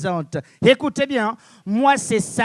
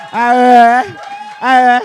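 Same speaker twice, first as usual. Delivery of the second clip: crie à la salle; 9 dB louder, slower than usual. Acted. Harangue la salle.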